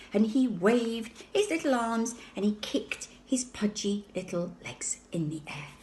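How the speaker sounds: ordinary delivery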